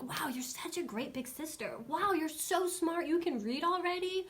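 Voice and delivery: sing-song proud-parent praise; gushing praise. Retold or imitated. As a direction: lay the praise on for a little kid. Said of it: "just praise her, like".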